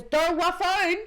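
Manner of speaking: put-on high, slow toddler babble. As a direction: mimic a three-year-old's mangled words. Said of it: "He goes".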